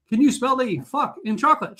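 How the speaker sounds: high sly clerk voice; clerk punchline setup. Retold or imitated. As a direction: set up the punchline. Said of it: "She goes".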